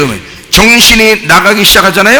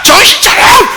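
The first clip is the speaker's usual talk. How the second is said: high-pitched, strained yell; acted rousing shout; 11 semitones higher than usual. Preacher acting out slapping someone to revive them, urgent shout. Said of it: "막 때리면서 뭐라 그래".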